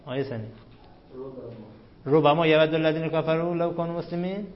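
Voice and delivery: usual speaking voice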